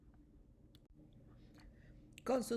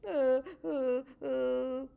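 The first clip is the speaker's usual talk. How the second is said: bear sobbing; 2 semitones higher, 4 dB louder, much slower than usual. A narrator's reading. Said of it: "Oso llora y balbucea".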